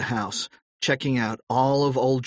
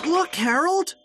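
excited cry. A narrator's reading. George calling Harold to see the treehouse, excited. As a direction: call out to a friend in delight. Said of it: "cried George".

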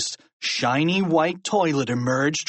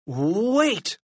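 high, drawn-out plea; pleading, raised boy's voice; urgent cry; 8 semitones higher than usual. A narrator's reading. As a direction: cry out to stop him. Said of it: "cried George".

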